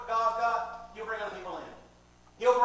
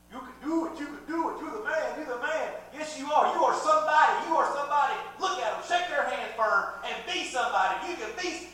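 higher, pumped-up hype voice; motivational tape; 6 semitones higher, 6 dB louder than usual. Retold or imitated. Preacher imitating a workout tape, hyped-up higher coaching. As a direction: pump them up like a self-help tape. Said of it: "these little motivational tapes".

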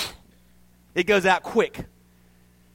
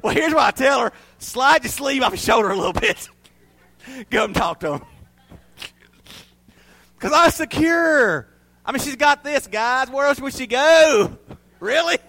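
higher, louder mock swagger; joking boast, pitched up and loud; mock cocky; 7 semitones higher, 6 dB louder than usual. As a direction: ham up the confident husband.

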